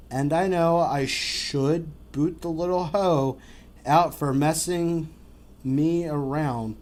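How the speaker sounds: slow, deliberate read-aloud of the post; dramatic reading. Acted. Host reads a Yahoo Answers post to co-host, slow dramatic reading. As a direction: read it out like a soap opera confession.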